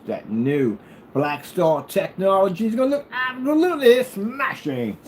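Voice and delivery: high-pitched British put-on; playful British TV-comedy accent; mock British accent. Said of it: "I'm gonna be talking like that".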